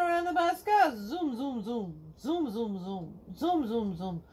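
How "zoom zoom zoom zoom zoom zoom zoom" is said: ordinary delivery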